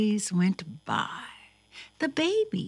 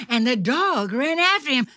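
fast, high, loud storytelling; chase narration; 6 semitones higher, 7 dB louder, much faster than usual. A narrator's reading. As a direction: race along with the chasing dog.

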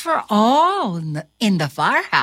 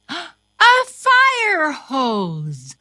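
excited gasp; 7 semitones higher, much slower than usual. Acted. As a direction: gasp then slow, higher wonder. Reader acts discovery.

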